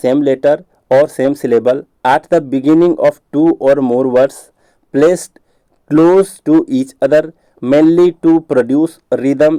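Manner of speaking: slow, spaced-out reading of a definition; measured classroom dictation. Shown as a demonstration. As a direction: read it out word by word for notes. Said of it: "reads the definition aloud slowly".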